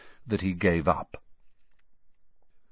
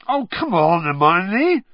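higher, louder protest through food; mouth full; 10 semitones higher, 8 dB louder than usual. A narrator's reading. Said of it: "spraying Harry with bits of Yorkshire pudding".

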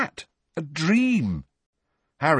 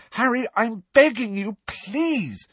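desperately; slower than usual. A narrator's reading. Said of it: "said Hermione desperately".

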